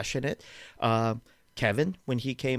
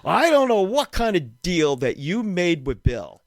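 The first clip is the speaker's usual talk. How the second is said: mock angry agent; 5 semitones higher, 4 dB louder than usual. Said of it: "He is pissed. He is mad".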